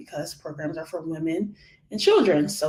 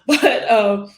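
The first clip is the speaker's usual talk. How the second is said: normal talking voice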